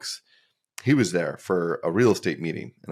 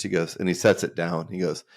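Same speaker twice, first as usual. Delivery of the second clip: ordinary talk, nothing marked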